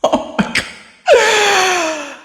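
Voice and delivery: drawn-out, high, stunned oh my God; slow stunned groan after a live with no audio; slow disbelief. Real, not acted. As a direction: stretch it out in disbelief. Overwhelmed by losing audio.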